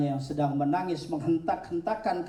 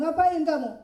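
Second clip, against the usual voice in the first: much higher and louder demand; stern challenge; 13 semitones higher, 4 dB louder than usual. Acted. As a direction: confront him sharply. Ibrahim confronts idol worshipper.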